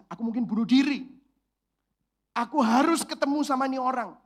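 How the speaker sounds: loud desperate inner cry. Retold or imitated. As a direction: voice a desperate woman's plea. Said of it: "dia pasti berpikir begini".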